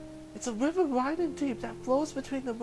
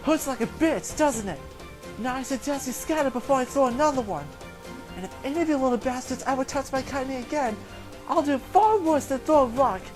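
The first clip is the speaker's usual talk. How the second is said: put-on louder, seething old-woman threat; thick with rage; 7 dB louder than usual. Old woman threatening the bullies, furious and loud. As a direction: growl the threat at the kids. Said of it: "a voice thick with rage".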